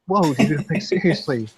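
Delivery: high, loud objection with a laugh breaking in; mock shocked protest. Retelling his reply to the dad's accusation, loud high protest. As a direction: act scandalized by the accusation, half laughing.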